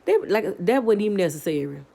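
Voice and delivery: ordinary delivery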